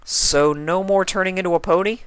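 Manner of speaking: put-on high, loud kid voice; Spike asking. Spike asking Twilight about the pony change, high. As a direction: ask hoping.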